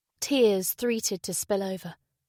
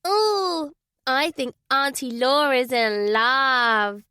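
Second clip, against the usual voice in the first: put-on teasing child; 3 semitones higher, 4 dB louder, much slower than usual. Child teasing aunt, voiced high.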